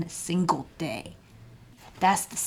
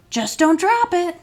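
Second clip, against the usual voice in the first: eager warning; 9 semitones higher, 7 dB louder than usual. Acted. Warning the football player.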